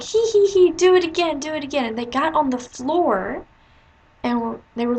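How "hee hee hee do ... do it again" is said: high, loud mischievous giggle then urging; giggling boys. Retold or imitated. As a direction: snicker like naughty eight-year-olds. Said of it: "were like".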